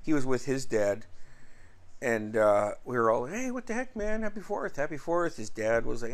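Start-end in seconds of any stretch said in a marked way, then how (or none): 3.2-5.4 retold cheery greeting. Friends greet Garrett on the Fourth, retold cheery greeting. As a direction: re-enact a friendly holiday hello, pitched up.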